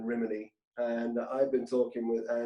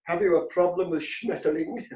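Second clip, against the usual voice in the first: stern German; 6 semitones higher, 3 dB louder than usual. Retold or imitated. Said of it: "the German guy's looking very serious".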